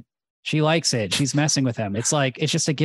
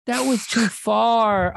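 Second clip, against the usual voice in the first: mock hurt; 5 semitones higher, slower than usual. Mock-hurt complaint after being slapped.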